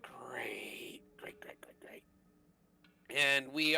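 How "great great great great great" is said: whispered great, great, great; whispering. Real, not acted. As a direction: mutter it under breath in dismay. Frustrated player whispering to self.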